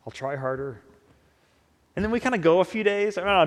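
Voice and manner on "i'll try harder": quiet, dejected promise; a hint of a sheepish sinner; downcast. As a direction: mumble it like a scolded kid. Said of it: "we sort of downcast say".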